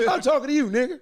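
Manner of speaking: lightly voiced country cowboy twang. Shown as a demonstration.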